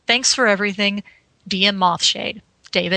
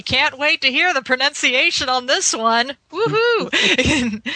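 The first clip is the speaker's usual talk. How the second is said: excited; 6 semitones higher than usual. Acted. Reading email, excited quote.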